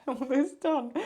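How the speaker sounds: bursts out laughing, loud and high; giggling through the last words. Real, not acted. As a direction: laugh it out at the overload. Laughing at own rambling.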